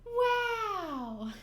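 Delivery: high, sing-song magical wow; fairy tale sound effect. Shown as a demonstration. Recalling a fairy tale sound effect, sing-song wow. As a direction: make it sparkle like a fairy tale. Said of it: "that fairy tale sound effect".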